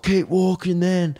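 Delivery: dismissive thug. Retold or imitated. Imitating the guy telling him to keep walking, slowly. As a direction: sneer it, backing down. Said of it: "And he's just like".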